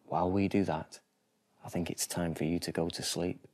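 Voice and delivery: usual speaking voice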